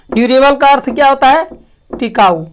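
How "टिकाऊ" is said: ordinary delivery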